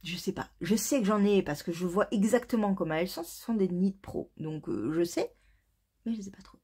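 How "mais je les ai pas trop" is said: soft unvoiced aside; whispering. Real, not acted. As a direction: whisper it like a secret.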